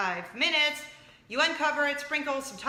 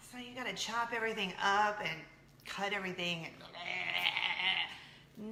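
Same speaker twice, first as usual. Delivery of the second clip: mock weary dread; 4 semitones lower, 6 dB quieter, slower than usual. Imagined viewer groaning about chores.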